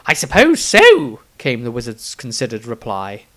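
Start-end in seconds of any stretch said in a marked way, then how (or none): 0.1-1.2 high, loud, eager wizard voice; excited eccentric wizard. Acted. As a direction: gush it out like a dotty old wizard. Wizard's considered reply.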